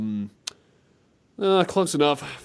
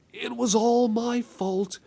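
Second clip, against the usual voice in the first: redoing the game line; 7 semitones higher, slower than usual. Shown as a demonstration.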